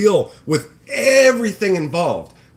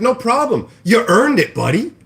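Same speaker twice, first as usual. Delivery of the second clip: higher, louder sarcastic cheer; fake-friendly sarcasm; 7 semitones higher, 5 dB louder than usual. Streamer retelling his fake-friendly reply to a freeloader, sarcastic cheer.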